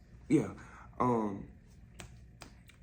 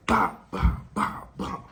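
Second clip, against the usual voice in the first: punch noises; 8 semitones higher, 6 dB louder than usual. Retold or imitated. Imitating the punches.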